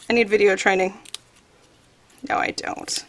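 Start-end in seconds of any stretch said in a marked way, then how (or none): 2.3-3.0 very soft, voiceless aside; whispered aside. Real, not acted. Crafter taking back her remark to herself, whispered aside. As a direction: whisper it under your breath.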